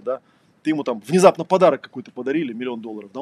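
usual speaking voice

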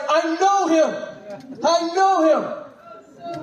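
high, slow, giddy and wide-eyed; Buddy the Elf at the mall, slow giddy high cry. Retold or imitated. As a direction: stretch it out like an overjoyed elf. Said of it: "He's like".

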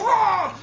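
a sudden high yelp as fire hits; pained raven cry. Acted. Raven struck by fire bursts out in a sharp high yelp. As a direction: shriek as the flames hit. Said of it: "he goes".

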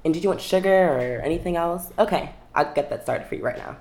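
high, loud, eager cashier voice; sweet, over-friendly shop-counter tone; customer service voice. Shown as a demonstration. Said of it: "Can I hear it?".

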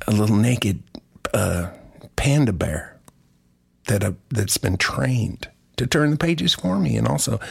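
ordinary talk

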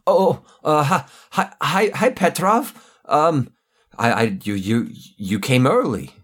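high, stammering, nervous goon voice; a scared henchman caught out, stumbling over words. Acted. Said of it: "a familiar voice go".

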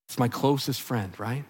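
low, soft, resigned murmur; bleak resignation. Acted. Preacher voicing a psalmist left in darkness, bleakly resigned. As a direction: let it sink low and quiet. Voices Heman's despairing last words.

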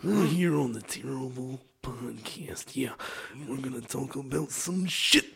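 raspy wrestler promo building from hushed to loud; Macho Man. Acted. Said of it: "now we're doing the fucking Macho Man".

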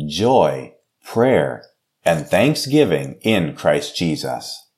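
ordinary delivery

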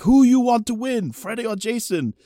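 put-on excited fan's question in a Japanese accent. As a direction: quiz eagerly in a mock Japanese accent. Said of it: "like, accent, can barely speak English".

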